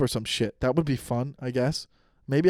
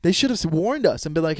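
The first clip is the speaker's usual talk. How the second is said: normal talking voice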